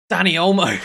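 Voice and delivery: scorer's name called high and loud; excited shout. Real, not acted. Gamer hailing Danny Olmo, excited shout.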